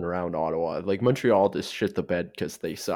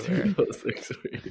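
laughing; 10 semitones higher than usual. Real, not acted. Teasing echo of a friend's jab.

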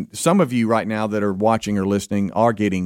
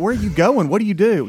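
pitched-up baffled questions; incredulous questioning; 8 semitones higher than usual. Retold or imitated. Man questions early riser's morning routine, high-pitched disbelief. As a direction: fire off questions in mock disbelief.

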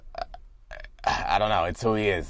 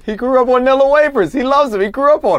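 excited laughing; 13 semitones higher, 6 dB louder than usual. Real, not acted. Cracking up at the analogy.

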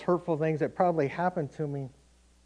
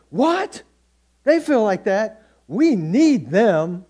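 high, loud, incredulous What? then drawn-out plea; mock shocked pastor; 6 semitones higher, 9 dB louder, much slower than usual. Pastor on the phone reacting in disbelief, mock shock. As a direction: play the pastor aghast, slowing right down at the end.

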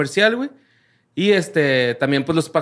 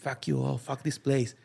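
retold rant under the breath; much softer, understated imitation of a rant; hushed mock quote; 11 dB quieter than usual. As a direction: imitate a walk-out speech, very softly. Quotes woman from viral plane video.

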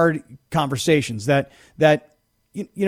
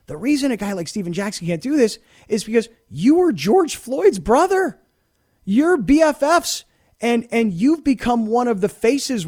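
impassioned emphasis; 6 semitones higher than usual. Real, not acted. Host making impassioned point.

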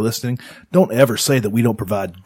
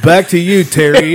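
bright anchor handoff; news anchor sign-off; 6 semitones higher, 7 dB louder than usual. Pretend. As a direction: toss it back like a TV anchor. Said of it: "news anchor sign-off parody".

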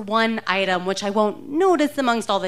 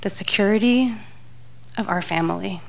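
disappointed husband. Retold or imitated. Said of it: "my husband's disappointed voice saying".

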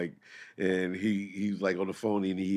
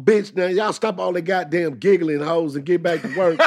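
bossy pimp; 7 semitones higher, 4 dB louder than usual. Retold or imitated. Imitates pimp ordering women to work.

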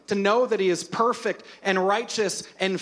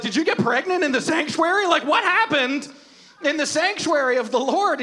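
high-pitched incredulous questioning; astonished crowd; 7 semitones higher, 3 dB louder than usual. Acted. Crowd guesses at his charades, incredulous. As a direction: act out a baffled crowd guessing wildly.

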